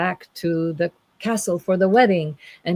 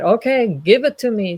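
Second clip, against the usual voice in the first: raised, demanding mock anger; angry knight; 5 semitones higher, 4 dB louder than usual. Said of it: "and the angry knight".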